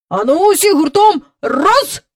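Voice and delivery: loud, high rallying shout; calling out. A narrator's reading. Park keeper rallying everyone to pull together, calling out. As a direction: shout a command to heave together. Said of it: "гукнув доглядач".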